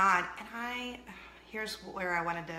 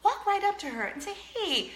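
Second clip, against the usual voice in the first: higher, sweet advice voice with a laugh; quick recovery; 6 semitones higher than usual. Retold or imitated. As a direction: cover the slip with bright motherly coaching. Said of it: "I said".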